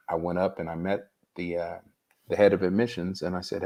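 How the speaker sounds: ordinary talk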